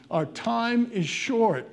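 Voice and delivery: slow it right down and warn them; grave warning. Acted.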